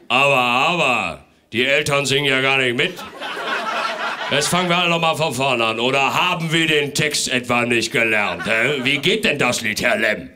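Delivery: loud, scolding Father Christmas; a strict Santa telling off the parents; stern Santa. Acted.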